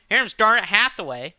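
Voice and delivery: ordinary talk, nothing marked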